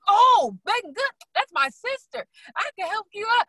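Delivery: very high, gushing husband impression; excited husband. Husband eagerly offering his wife help, excited. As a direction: burst out loud, stretch the name, then offer eagerly high up.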